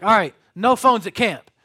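loud youth-pastor rule-setting; retold firm announcement. Youth pastor to kids going to camp, loud announcement. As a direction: lay down the law loudly to a group.

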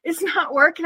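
high, fretful mock husband; husband panicking. Husband failing with swingers, mock panicked whine. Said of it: "my husband's over there going".

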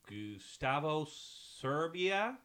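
"Gustavo Serbia" drawn out, slow, American teacher reading a roll; teacher mangling the name. Retold or imitated. Teacher calling roll, slowly in an American accent. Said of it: "llega mi nombre y dice".

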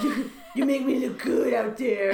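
lightly hammed-up superhero voice; Batman. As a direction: put on a mock hero voice. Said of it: "actually, I'm gonna do the Batman".

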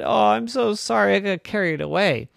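high opening, coming down; apologetic mother. Acted. Mommy saying sorry to Irene, apologetic.

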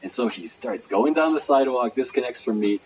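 ordinary talk, nothing marked

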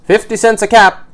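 high, loud street-seller's cry; calling out. A narrator's reading. Peddler selling caps in town, calling out loudly. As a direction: hawk your wares across town.

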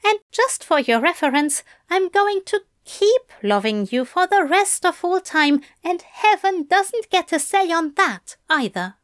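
Crowley defying heaven for love; Crowley vowing. A narrator's reading. Crowley's voice in narration.

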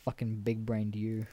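mock congratulate yourself; sarcastic self-praise; smug sarcasm. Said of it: "And I'm just like".

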